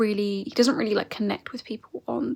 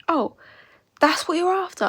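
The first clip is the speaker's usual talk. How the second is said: mock realisation; 8 semitones higher than usual. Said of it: "he's like".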